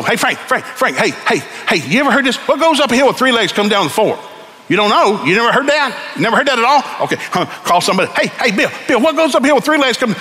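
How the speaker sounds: high, excited, pestering calls to friends; mock panicked businessman asking around; frantic phone call. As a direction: play the businessman desperate for the answer.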